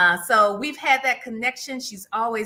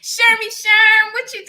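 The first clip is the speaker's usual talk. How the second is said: high, loud, stretched-out singsong hello; playful nickname greeting; 10 semitones higher, 8 dB louder than usual. Real, not acted. Host playfully greets her old friend.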